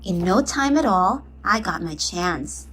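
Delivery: plain reading voice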